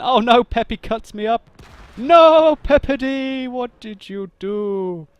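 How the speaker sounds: loud high wail, slowing and sinking at the end; mock dismay. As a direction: cry out in hammed-up, drawn-out despair. Teammate blunders, mock wail of dismay.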